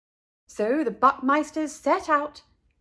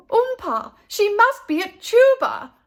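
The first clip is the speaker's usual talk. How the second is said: high, loud eager guess; mother guessing excitedly; sudden realization; 11 semitones higher, 8 dB louder than usual. Acted. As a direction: burst out with the idea.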